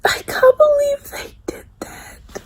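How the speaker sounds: crying, half-whispered, breaking high; trying not to cry but losing it; fighting back tears. Real, not acted. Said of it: "I'm trying so hard not to cry".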